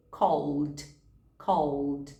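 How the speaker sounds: "Called" ends l straight into d, one syllable; teacher models "Called" as call-d. Shown as a demonstration. Teacher shows the right past tense. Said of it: "somente o som da letra D".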